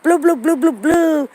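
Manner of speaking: angry men in line jeering at a mixed couple, loud; angry men heckling. Retold or imitated. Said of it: "They was like".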